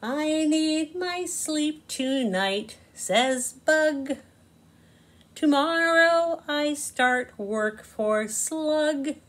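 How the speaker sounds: a gentle little bug ready for bed; sleepy Bug. Acted. Bug telling the children he needs sleep, lightly voiced. Bug's lines in read-aloud story.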